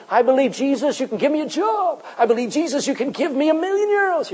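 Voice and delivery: high, sing-song wishful prayer; hammed-up pleading believer; mock greedy prayer. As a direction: pray to a genie for cash. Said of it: "want Jesus to be this genie".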